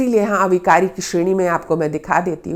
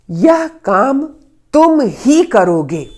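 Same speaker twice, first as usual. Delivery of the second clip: "तुम ही" slowed and punched louder; stress on तुम ही; 3 semitones higher, 4 dB louder, slower than usual. Shown as a demonstration. Teacher showing emphasis on "तुम ही" to the class. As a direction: lean hard on "तुम ही" to insist. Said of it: "एक बलाघात किया".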